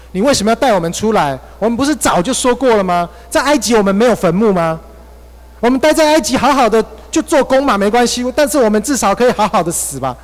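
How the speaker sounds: high, loud, frantic protest; panicked complaint. Retold or imitated. Israelites panicking at Moses by the Red Sea, high and loud. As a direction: wail at Moses as if trapped by the sea. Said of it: "他们非常的惊慌，非常害怕".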